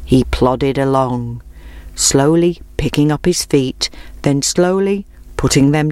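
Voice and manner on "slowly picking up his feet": usual speaking voice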